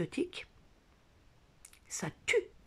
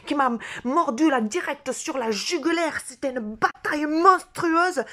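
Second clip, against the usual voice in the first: higher, louder mock-epic telling; hammed-up drama; 8 semitones higher, 9 dB louder than usual. Telling viewers about the spider bite, hammed-up epic drama. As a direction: ham up the monstrous battle. Exaggerated spider attack story.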